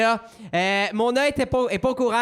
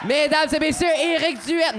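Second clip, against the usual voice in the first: grand entrance announcement; 6 semitones higher than usual. Pretend. Fake guest announcement.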